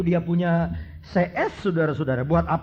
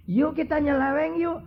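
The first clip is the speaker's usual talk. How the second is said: high coaxing lure; tempting devil; 9 semitones higher than usual. Acted. Preacher voicing the devil luring the empty-hearted, high and coaxing. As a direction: coax like a sly tempter.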